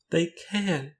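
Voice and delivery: high, tearful contradiction; sobbed. A narrator's reading. Companion contradicting rector's wife, sobbed. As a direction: lift the pitch into a plaintive cry. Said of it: "she sobbed".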